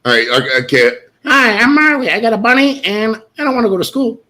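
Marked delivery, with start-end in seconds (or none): 1.2-4.2 high, childlike put-on voice; Marley impression. Doing Marley on request, high and childlike. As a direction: chirp it like a little girl. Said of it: "Do an impression of me".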